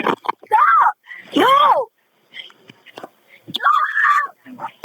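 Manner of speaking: real panicked high scream. Real, not acted. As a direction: scream at the driver to stop, in short bursts. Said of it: "who are you screaming at".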